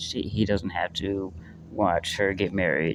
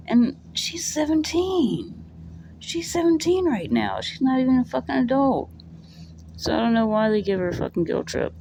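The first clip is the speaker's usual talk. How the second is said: high, outraged rant; indignant; 13 semitones higher than usual. Real, not acted. Vlogger defending a teen character, high and indignant. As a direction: protest on her behalf. Indignant about guilt trip.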